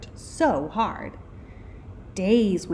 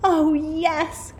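put-on high, loud eager children; excited cry; 9 semitones higher, 5 dB louder than usual. A narrator's reading. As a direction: cheer the idea like keen kids. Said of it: "cried the kids".

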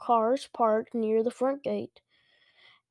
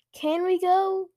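put-on higher, louder insistent kid's question; demanding; 7 semitones higher, 5 dB louder than usual. A narrator's reading. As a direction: demand it like an impatient child. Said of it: "Luke demanded".

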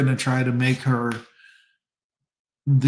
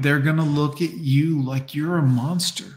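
ordinary talk, nothing marked

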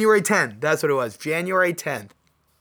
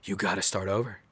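stern self-talk; 7 semitones lower, 6 dB quieter than usual. Retold or imitated. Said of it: "I'm like".

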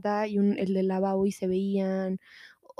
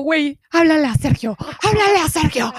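higher, louder frightened begging; urgent, scared repeated call; a frightened friend pleading to call for help; panicked plea; 6 semitones higher, 6 dB louder than usual. Retold or imitated. Scared friend begs to call Sergio.

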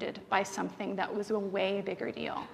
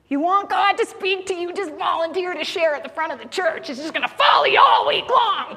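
loud, high, laughing preacher quote; mock sermon shout; 6 semitones higher, 8 dB louder than usual. Teasing Kim by shouting her sermon back, laughing. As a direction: boom it out like a pulpit warning, laughing. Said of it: "right, Kim?".

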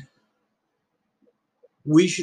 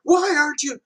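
exasperated demand; 12 semitones higher, 3 dB louder than usual. Retold or imitated. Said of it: "he's like".

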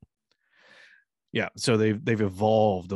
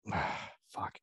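breathy whispered "ah, fuck"; whispered curse; 4 semitones lower, 10 dB quieter than usual. Retold or imitated. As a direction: mutter it under your breath. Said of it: "she's like".

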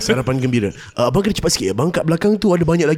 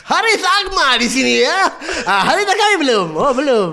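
mall MC voice; 9 semitones higher than usual. Retold or imitated. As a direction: grill the kid like a cheesy mall MC. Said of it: "K L be like".

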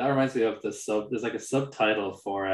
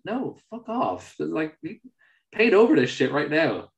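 high-pitched exasperated mock refusal; mock indignation; 6 semitones higher, 4 dB louder than usual. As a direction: shut the artist down in pretend outrage. Imagined angry reply to artist.

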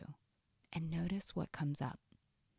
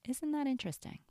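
higher, in one connected phrase; curious noticing; 9 semitones higher, faster than usual. Shown as a demonstration.

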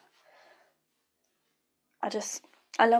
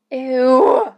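disgusted ew; 2 semitones higher, 8 dB louder than usual. Real, not acted. Disgusted reaction to wedding colour.

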